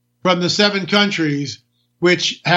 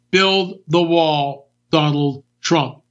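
slogan; 4 semitones lower, much slower than usual. Acted. Slogan, slow and different timbre.

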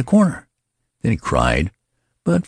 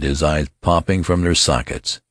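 usual speaking voice

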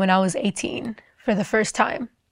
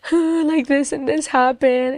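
higher, lightly tearful retelling; crying confession; 5 semitones higher, slower than usual. Retold or imitated. Girl confessing to the preaching lady, mildly tearful. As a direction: spill it out through mild tears. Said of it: "I just began crying".

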